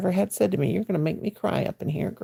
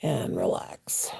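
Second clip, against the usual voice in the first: soft breathy whisper, slow; whispered relax; 10 semitones lower, 8 dB quieter, much slower than usual. Real, not acted. Telling her live viewers to relax, in a slow whisper.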